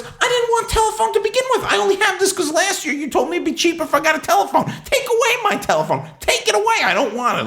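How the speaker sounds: very high-pitched indignant protest; hammed-up outrage, voice way up high; exasperated retold rant. Customer refusing telephone service on the call, high exasperated rant.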